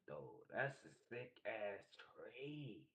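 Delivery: ordinary talk, nothing marked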